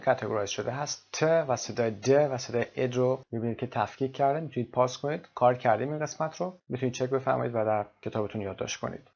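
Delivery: ordinary delivery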